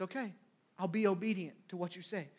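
quiet, resigned-sounding agreement; subdued giving in. Acted. Peter agreeing to obey Jesus, subdued. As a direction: drop right down softly and submit. Peter gives in after complaining.

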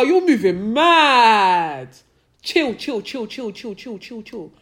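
imitated sayings. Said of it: "those sayings".

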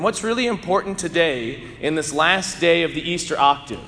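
usual speaking voice

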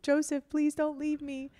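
high, imitated crying declaration of love; mock tearful pleading. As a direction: plead in a put-on high voice, like a weeping movie heroine. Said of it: "and she cries".

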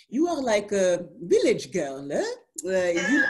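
lightly imitating a husband's French accent; a teasing hint of French accent. Wife quoting her Cameroonian husband, light French accent. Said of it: "sexy French accent".

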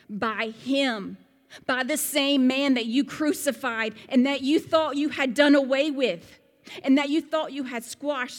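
loud, high, fervent; preaching at full force. Retold or imitated. Peter defending the healing before rulers, loud preaching.

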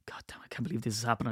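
hushed, whispered fan lament; imitating a despairing fan in a rushed whisper; mock dismay. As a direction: mutter it under your breath, fast and soft. Mimicking raging fans' dismay.

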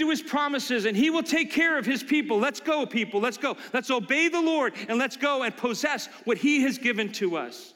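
rising, rousing preacher's call; rally the people forward; preaching. Real, not acted. Preacher urging the people onward in a rousing call.

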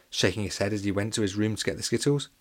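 ordinary delivery

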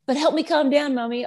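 imitating child's tantrum. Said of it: "she's crying".

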